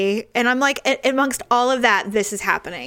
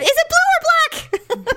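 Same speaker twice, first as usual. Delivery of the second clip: mock high quote; slower than usual. Retelling own question, laughing.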